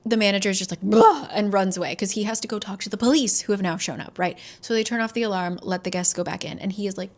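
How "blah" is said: loud exasperated blah; dismissive blah. Retold or imitated. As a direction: throw up hands and blurt it. Manager dismissing Langdon.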